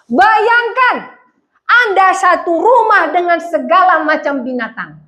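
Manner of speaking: usual speaking voice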